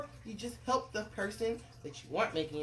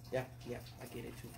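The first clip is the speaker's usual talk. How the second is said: plain reading voice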